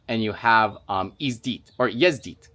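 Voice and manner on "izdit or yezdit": izdit then yezdit spoken as Russian, yezdit as yez-DEET; Russian verb, self-corrected. Shown as a demonstration. Correcting a Russian verb for viewers, yezdit in Russian.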